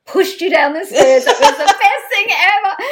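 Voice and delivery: laughing glee. Retold or imitated. PR friend on the phone, laughing in glee. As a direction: crow with delight at the fall.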